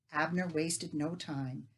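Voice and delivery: plain reading voice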